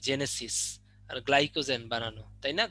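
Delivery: ordinary delivery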